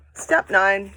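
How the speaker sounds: normal talking voice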